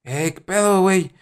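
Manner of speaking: high, punchy regiomontano greeting; brash northern Mexican accent; Monterrey accent. Retold or imitated. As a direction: toss it off like a tough guy from Monterrey. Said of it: "así como que".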